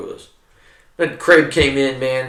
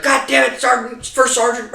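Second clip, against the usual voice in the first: much higher, angry retold outburst; angry sergeant major; 12 semitones higher than usual. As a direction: bark it like a furious sergeant major. Angry sergeant major yelling at him.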